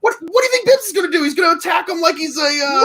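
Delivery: fast, high, shouting objection; heated outburst. Real, not acted. Heated debate over Bibbs.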